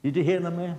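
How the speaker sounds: ordinary talk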